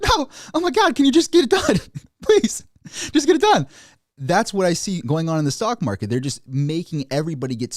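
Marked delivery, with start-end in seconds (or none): 0.0-3.7 much higher, louder pleading with a laugh; delirious kid. Retold or imitated. The kid in the piercing seat begging to get it done, frantic high plea. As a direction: beg for it to be over, frantic. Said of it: "like, delirious".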